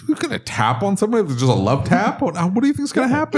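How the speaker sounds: high disbelieving questions; incredulous. Real, not acted. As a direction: ask it in disbelief. Incredulous reaction to aggressive driving.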